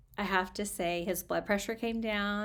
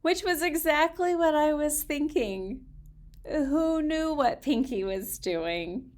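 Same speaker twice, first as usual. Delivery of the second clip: expressive storybook reading voice; reading aloud; 4 semitones higher, 8 dB louder than usual. Acted. Reading a children's author's letter to her audience, expressive storybook voice. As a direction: read the letter to listeners, lively.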